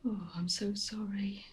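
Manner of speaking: slow, broken whisper of apology; grieving whisper. Acted. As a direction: whisper it, barely holding together. Mother grieving over son.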